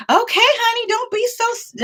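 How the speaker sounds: very high, sassy mock scolding. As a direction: talk down to him, high and sassy. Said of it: "We were, like, obnoxious like that".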